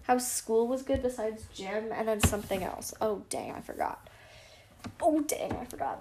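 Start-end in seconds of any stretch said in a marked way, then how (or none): 3.0-4.1 sarcastic. Shown as a demonstration. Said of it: "That sounded very sarcastic". 5.0-6.0 high, sarcastic "Oh, dang". Shown as a demonstration. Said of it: "That sounded very sarcastic".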